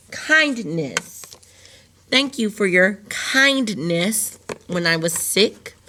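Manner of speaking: ordinary delivery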